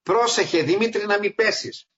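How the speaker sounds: women warning him. Retold or imitated. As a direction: imitate the women calling up the ladder. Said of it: "μου λένε οι γυναίκες". (οι γυναίκες φωνάζουν στον Δημήτρη στη σκάλα, ψιλή προειδοποίηση)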